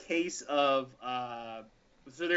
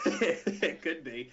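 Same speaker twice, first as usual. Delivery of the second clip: slow, laughing reply; 3 dB louder, much slower than usual. Real, not acted. Storyteller laughing at friend's joke. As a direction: chuckle it out.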